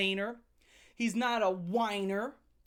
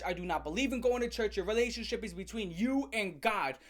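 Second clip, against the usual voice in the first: usual speaking voice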